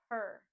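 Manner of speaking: Per alone, firmly; stressed syllable alone. Shown as a demonstration. Teacher drilling the first syllable, firm Per. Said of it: "we put the stress on the first syllable".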